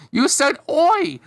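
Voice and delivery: high, slow, gleeful cartoon kid; delighted teasing in a high voice; amused exclamation. A narrator's reading. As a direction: crow it way up high, stretching the last word. Larry laughing at the French word.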